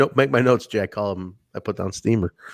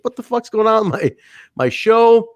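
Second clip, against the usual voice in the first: imitating the host's rising outrage, higher and faster; mock furious Disco Inferno; 7 semitones higher than usual. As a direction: rant about his ruined show. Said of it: "And he's like".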